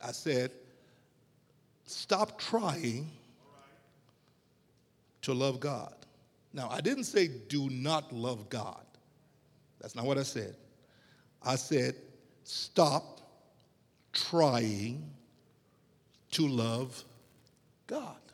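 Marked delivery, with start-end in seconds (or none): 1.8-5.9 "stop trying", then a long pause before "to love God"; spaced-out, emphatic repeat; deliberate emphasis. Real, not acted. Repeats his challenge for emphasis. 12.4-18.2 "stop", "trying", "to love", "God", each set apart by pauses; slow, deliberate correction with long gaps; word-by-word emphasis. Real, not acted.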